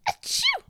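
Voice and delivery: sharp high sneeze; loud sneeze. A narrator's reading. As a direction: sneeze loudly. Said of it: "Mom sneezed again".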